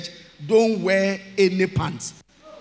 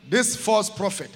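ordinary talk

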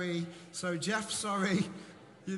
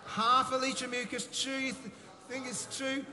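excited calling out; 6 semitones higher, 3 dB louder than usual. Real, not acted. Excited quiz reveal to congregation.

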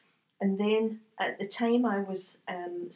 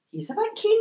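wry amusement; 13 semitones higher, 5 dB louder than usual. Retold or imitated. Retold private thought about agent.

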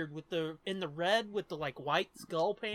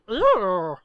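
disgusted groan; 2 semitones higher, 14 dB louder than usual. Retold or imitated. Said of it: "be like".

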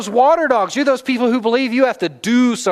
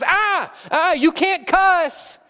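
mock scold; 9 semitones higher, slower than usual. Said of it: "the one that goes".

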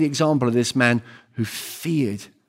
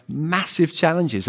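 normal talking voice

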